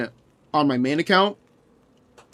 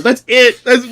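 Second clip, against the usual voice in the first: usual speaking voice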